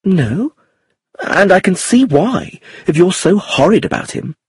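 put-on high, cross young woman's retort; indignant. A narrator's reading. Iris defending Tony against George's warning, indignant. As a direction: snap back, pitched high.

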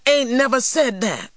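high-pitched insistent denial; indignant denial. A narrator's reading. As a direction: protest your innocence, pitched well up. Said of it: "denied T J".

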